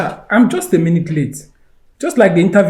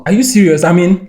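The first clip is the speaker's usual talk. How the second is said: surprised joy; 2 semitones higher, 5 dB louder than usual. Acted. Jobseeker hears that he is hired.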